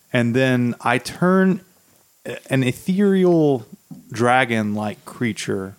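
normal talking voice

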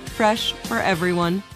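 ordinary talk

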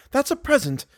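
high, flustered defence; embarrassed. A narrator's reading. Blushing girl explains the ring, embarrassed. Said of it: "vainly striving to hide her embarrassment".

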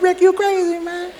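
high laughing echo of the line; mocking echo. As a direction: parrot it back, laughing. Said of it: "He said".

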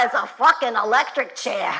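ordinary delivery

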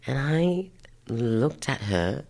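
usual speaking voice

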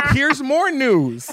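high, loud, slow hammed-up announcement; milk the tiny line; mock stage line. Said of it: "my line was".

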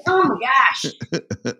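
comic exasperation. Retold or imitated.

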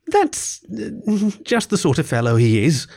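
starts high, drops to a mild letdown; hesitant, mildly let-down remark; disappointed. A narrator's reading. Rat after Badger leaves, mildly disappointed. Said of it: "observed the disappointed Rat".

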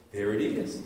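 hushed, barely there; like a soft inner voice from far away; still small voice. Retold or imitated. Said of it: "that still small voice that says".

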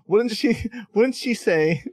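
high, giggly, drawn-out question; laughing through the words; laughing while asking. Real, not acted. Laughing at forgetting the name, wouldn't she say.

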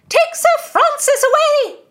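very high, loud shrieked order; crying out. A narrator's reading. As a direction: screech the command. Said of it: "cried Mistress Aglynsby".

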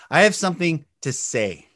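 projected, clearly voiced statement; full volume. Shown as a demonstration. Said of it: "The volume".

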